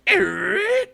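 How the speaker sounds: high, loud screeching sound effect; imitated screech. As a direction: screech to a sudden halt with your voice. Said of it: "then it all goes".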